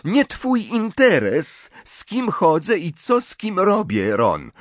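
slow, high-pitched challenge; defiant. A narrator's reading. Ginny defies Ron over whom she dates, challenging. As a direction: stand up to your brother, drawing the words out. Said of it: "patrząc wyzywająco na Rona".

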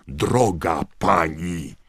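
roared. A narrator's reading. Knot roaring at McGonagall, enraged. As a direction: bellow it down at her. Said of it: "ryknął Knot".

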